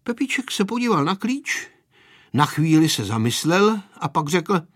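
plain reading voice

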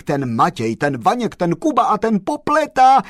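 high, loud excited tirade; joyful rant. Acted. Postman teasingly scolds the chauffeur, high, loud and excited. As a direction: rattle off the teasing names in delight.

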